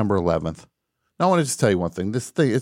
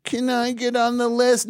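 high, slow pleading mock caller; whiny caller; 13 semitones higher than usual. Host mocking fans begging for guest list, high whine. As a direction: whine it like a ticketless fan begging. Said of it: "And I don't wanna hear".

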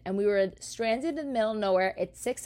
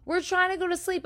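high, fast whiny voice; mock whiny wife; 11 semitones higher, faster than usual. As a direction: mimic the wife's prissy complaint. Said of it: "She was like".

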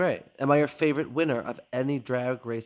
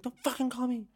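imitated yell; 10 semitones higher, 8 dB quieter than usual. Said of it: "Did he go yell at me?".

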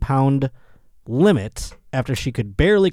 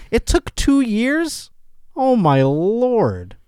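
disbelief; 10 semitones higher, 3 dB louder, much slower than usual. Real, not acted. Disbelief at two years.

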